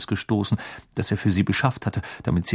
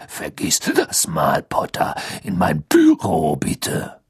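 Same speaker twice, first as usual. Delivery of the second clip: gruff Moody voice; 5 semitones lower, slower than usual. Acted. Moody orders Harry gruffly.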